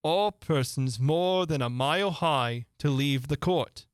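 slow, ceremonial proclamation; reading out. A narrator's reading. King reads a rule from his book, slowly proclaiming. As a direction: read it out grandly, word by word. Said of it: "read out from his book".

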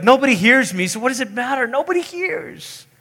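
high mock complaint, climbing; despairing whine. Preacher voicing how we feel unheard, mock despair. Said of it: "this is sort of how we feel".

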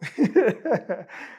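a short, bright burst of laughter; amused laugh. Real, not acted. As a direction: laugh at how absurd it sounded. Laughing at own retold question.

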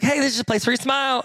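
high, perky vlog greeting; imitated young YouTuber. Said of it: "She's like".

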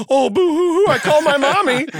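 high, loud mock boo-hoo wail; hammed-up crybaby taunt; fake crying. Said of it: "doing the wah and crying".

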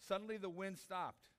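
normal talking voice

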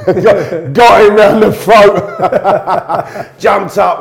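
loud, high delivery breaking into laughter; drawn-out words bursting with laughs; laughing while telling. Real, not acted. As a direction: crack up as you tell the tale. Laughing through his own brawl story.

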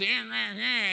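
whiny "eh, eh, eh" noise; mocking little bleats of complaint; mock whining. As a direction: imitate a complainer's wordless whine. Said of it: "quit whining about".

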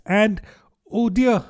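high, dismayed exclamation; sorrowful cry. A narrator's reading. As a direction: break off in sudden regret. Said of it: "cried Alice in a sorrowful tone".

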